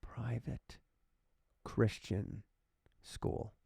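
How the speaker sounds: slow whisper, word by word; stunned hushed echo. Real, not acted. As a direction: whisper each word with pauses between. Echoes the words in shock.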